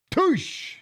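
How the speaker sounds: high zippy whoosh, imitating a car speeding off; playful getaway whoosh; whoosh sound effect.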